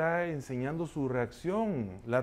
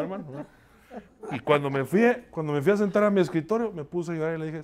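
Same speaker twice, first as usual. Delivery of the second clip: ordinary delivery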